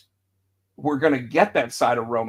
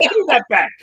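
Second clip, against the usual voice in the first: mock demand; 9 semitones higher, 7 dB louder than usual. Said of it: "And he'd be like".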